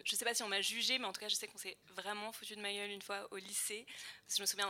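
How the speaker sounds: ordinary talk, nothing marked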